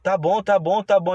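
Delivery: hurried frightened agreeing; scared giving in. Retold or imitated. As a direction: mimic a spooked vendor caving fast. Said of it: "Ele ficou todo assustado".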